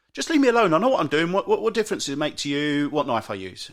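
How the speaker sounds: loud, high burst, easing down as it goes on; mock outburst of someone fed up with nagging; defensive snapping. Acted retort when criticised over knife.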